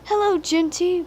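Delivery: high cheerful engine call; whistled greeting. A narrator's reading. Percy greets his passing friend, lightly voiced high call. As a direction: toot a bright hello across the line. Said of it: "whistled Percy".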